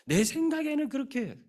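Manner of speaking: high, far-off mock of a proud general; mocking voice of Naaman's self-will. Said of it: "내 생각 때문에".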